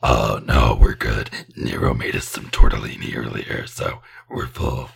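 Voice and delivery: very low, breathy whispering demon. Pretend. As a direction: rasp it out like a polite demon. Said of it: "Your demon voice is too".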